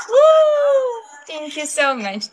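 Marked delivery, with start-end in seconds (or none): 0.1-1.0 high, loud whoop; cheer. Real, not acted. Streamer cheering a viewer's gift, high and loud.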